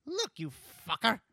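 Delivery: high cartoon rabbit voice; squeaky, frantic toon rabbit; Roger Rabbit voice. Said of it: "Roger Rabbit's like".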